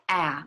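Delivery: "ah" with the open front vowel of cat. Shown as a demonstration. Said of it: "like cat".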